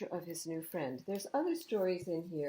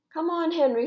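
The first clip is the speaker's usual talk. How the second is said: much higher, louder cheerful call; eager call; 11 semitones higher, 12 dB louder than usual. A narrator's reading. Thomas urging Henry to cross the bridge, eager. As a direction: coax a friend across. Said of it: "said Thomas".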